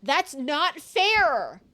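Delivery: long, drawn-out loud wail; outraged protest. Acted. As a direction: stretch it out in loud, high protest. Protest at damning evidence.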